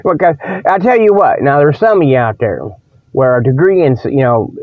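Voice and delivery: ordinary delivery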